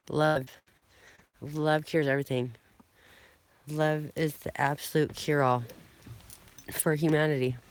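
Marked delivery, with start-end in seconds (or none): none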